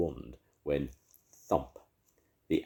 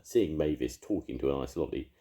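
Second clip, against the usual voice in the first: plain reading voice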